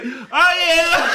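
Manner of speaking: high, loud, laughing reply; mock yelled answer. Friend yells back from another room, comic holler. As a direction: holler back eagerly, hammed up. [name] answers the yell, acted.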